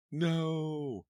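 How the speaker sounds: high, loud anguished no; shrill scream. A narrator's reading. Clown screaming in shock at the body, high and loud. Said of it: "a shrill scream cut through".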